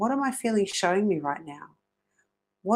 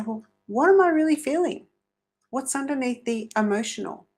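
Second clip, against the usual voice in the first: curious self-questioning; 6 semitones higher than usual. Shown as a demonstration. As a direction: ask yourself with lively, lifted curiosity. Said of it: "and just go".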